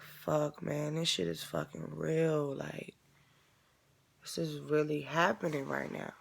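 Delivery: soft, slow, shaken murmur; hushed numb realization; stunned disbelief. Real, not acted. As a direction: say it quietly as it sinks in. Realizing the death is real.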